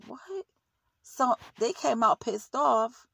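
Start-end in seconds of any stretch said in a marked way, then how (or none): 0.1-0.4 high, soft rising what; disbelief, hushed but sharp; retold shock, much higher yet quieter; incredulous what. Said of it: "And I said".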